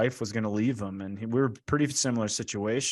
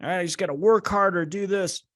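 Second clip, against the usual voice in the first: higher, louder, faster self-push; driven urgency; 8 semitones higher, 3 dB louder than usual. Retold or imitated. A guy pushing himself to work harder, revved up. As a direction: rev yourself up to grind harder. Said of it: "Especially guys".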